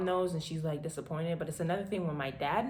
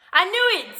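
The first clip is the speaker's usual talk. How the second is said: triumphant I knew it; 12 semitones higher, 13 dB louder than usual. Retold or imitated. Father's triumphant exclamation.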